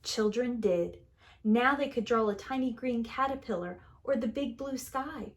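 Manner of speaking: plain reading voice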